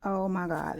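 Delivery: normal talking voice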